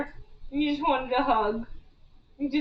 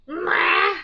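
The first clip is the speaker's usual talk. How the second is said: cat yowl; 6 semitones higher, 5 dB louder than usual. Retold or imitated. Mimicking the cat's cry.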